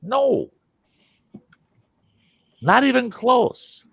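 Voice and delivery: desperate denial. Retold or imitated.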